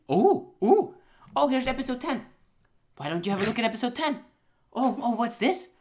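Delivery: high, fast mock presenter; salesman voice. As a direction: pitch it like a cheesy salesman. Voicing the studio presenting.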